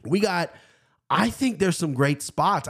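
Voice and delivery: ordinary talk, nothing marked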